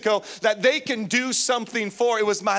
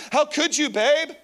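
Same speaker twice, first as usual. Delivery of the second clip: pitched up in mock accusing whine; higher mock accusation; 7 semitones higher, slower than usual. Husband playfully blaming his wife for the accident. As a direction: blame her, half joking.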